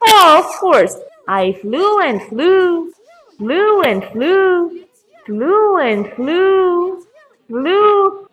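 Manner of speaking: high, drawn-out singsong; rambling raven. Acted. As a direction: stretch each repeated word in a long high swoop. Raven starts the same again.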